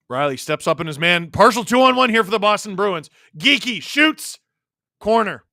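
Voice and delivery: loud, urgent hockey call, rising with the rush; excited commentator, pitch climbing; live play-by-play. Real, not acted. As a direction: call the action as it heats up.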